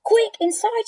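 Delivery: mother shouting in the storm; urgent shout. Acted. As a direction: hurry them in. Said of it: "shouted Missus Thomas".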